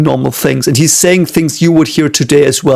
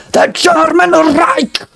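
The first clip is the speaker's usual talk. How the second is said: high, drawn-out mock shout; hammed-up harsh ranting German, slowly stretched; Hitler; 13 semitones higher, slower than usual. Imitating how people think German sounds, hammed-up harsh shout. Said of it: "German is the way Hitler spoke".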